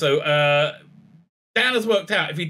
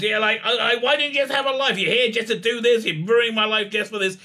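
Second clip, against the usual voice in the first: losing it; 7 semitones higher than usual. Retold or imitated. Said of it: "she really, like, loses it".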